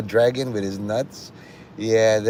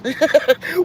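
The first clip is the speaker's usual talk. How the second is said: high laugh. Real, not acted. Laughing at heaps joke.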